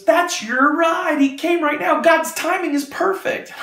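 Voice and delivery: very high, drawn-out excited exclamation; retold excitement. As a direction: stretch it high, imitating her delight. Guard exclaiming as the ride arrives.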